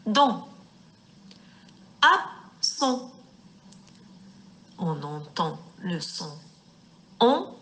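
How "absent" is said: Absent split as AB, pause, SENT; syllable by syllable. Shown as a demonstration. Teacher isolating the second syllable, Absent broken as AB-SENT. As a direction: say Absent in two parts, AB high, then SENT. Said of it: "dans la syllabe son".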